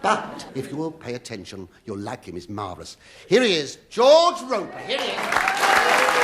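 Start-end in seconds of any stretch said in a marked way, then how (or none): 3.3-6.2 soaring, stretched "Here he is"; high, loud showman's call; grand introduction. Acted. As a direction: announce him in a high, slow flourish. Comic big introduction of guest.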